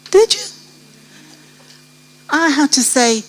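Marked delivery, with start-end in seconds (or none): 0.1-0.4 high challenging question. Real, not acted. Challenges the audience.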